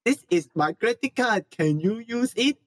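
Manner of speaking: put-on high, halting Japanese accent. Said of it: "Tim was being Japanese".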